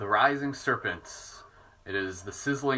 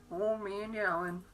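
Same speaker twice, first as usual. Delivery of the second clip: put-on slow, higher crooning old man; 8 semitones higher, 7 dB quieter, slower than usual. Shown as a demonstration. Teasing the dog in a higher cooing voice. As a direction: lift it up and stretch it out.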